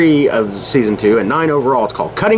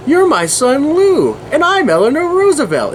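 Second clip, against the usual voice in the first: crazy mom voice; 13 semitones higher than usual. Retold or imitated. Retelling the crazy mom claiming to be Eleanor Roosevelt, hammed-up high. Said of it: "And then the crazy mom".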